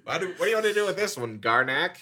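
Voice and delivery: high comic alien asking a crony; hammed-up alien villain, breaking into laughter. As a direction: put on a silly high alien, stretching the last words. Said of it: "work out multiple alien voices".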